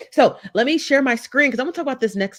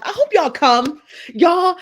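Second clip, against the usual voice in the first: hyped invite; 10 semitones higher, 6 dB louder, slower than usual. Real, not acted. Calling out to audience.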